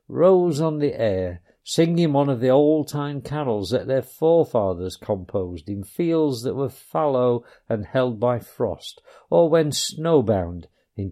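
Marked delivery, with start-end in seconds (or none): none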